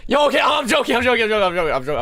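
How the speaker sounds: high, loud burst of repeated retractions; frantic backpedalling. Real, not acted. Streamer hastily taking back his teasing, frantic yelping. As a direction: yelp it out fast, then come down.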